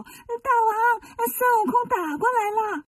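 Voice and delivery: high, urgent little-demon voice; little demon reporting. Acted. Little demon reports to his king, in a high urgent voice. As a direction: squeak out the news to the king.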